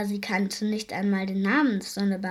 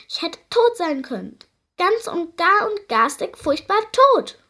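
high, louder, overdramatic creature wail; whiny creature; 8 semitones higher, 6 dB louder than usual. A narrator's reading. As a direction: play the little drama queen. Tiny creature complains dramatically. (hohes, theatralisches Jammern des Wesens)

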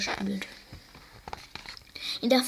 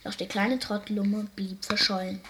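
ordinary delivery

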